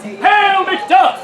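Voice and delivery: high, grand hail; King's messenger. Acted. Messenger calling to McDuff, high proclamation. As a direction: hail him high like a herald. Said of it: "I am the King's messenger".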